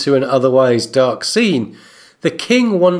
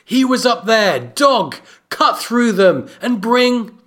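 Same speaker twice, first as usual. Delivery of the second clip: higher, imperious royal orders; urgent command; 7 semitones higher than usual. A narrator's reading. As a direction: send the guard off with contained fury. Narrator voices Joffrey ordering his guard.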